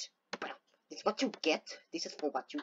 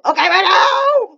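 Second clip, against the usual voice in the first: loud high yell to start the run; excited shout; 9 semitones higher, 10 dB louder than usual. Real, not acted. Gamer shouting at the screen, excited. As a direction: shout it out as the moment comes.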